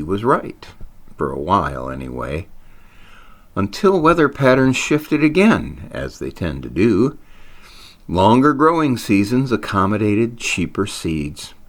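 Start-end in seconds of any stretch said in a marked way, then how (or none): none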